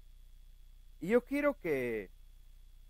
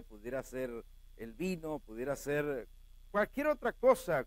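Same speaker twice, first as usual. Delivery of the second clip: ordinary talk, nothing marked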